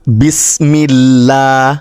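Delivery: বিসমিল্লাহ chanted slowly with a thin, light lam in আল্লাহ; thin lam recitation. Shown as a demonstration. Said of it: "এটাকে আমরা পাতলা করে উচ্চারণ করব". (পাতলা লামে ধীরে বিসমিল্লাহ)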